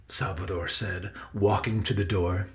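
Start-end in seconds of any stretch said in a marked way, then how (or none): none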